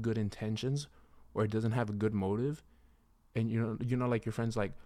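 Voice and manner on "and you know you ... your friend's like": ordinary delivery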